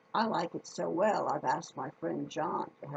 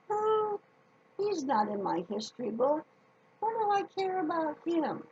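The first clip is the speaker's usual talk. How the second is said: high whiny grumble, hammed up for the kids; mock grumbling; 10 semitones higher than usual. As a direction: pretend to be a bored child complaining. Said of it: "I can just hear you grumbling".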